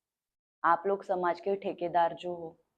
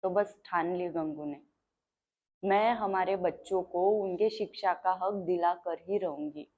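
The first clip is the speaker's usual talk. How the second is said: ordinary talk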